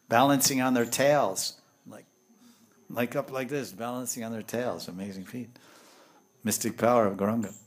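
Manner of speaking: normal talking voice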